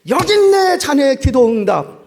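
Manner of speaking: high, loud, slow curt delivery; sarcastic angry shout; angry outburst. Acted. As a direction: shove the money over in resentment. Slams door, angry handover.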